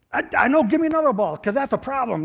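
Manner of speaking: high, loud whiny demand; hammed-up childish complaint; mock frustrated kid. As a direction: act the kid wanting his ball back.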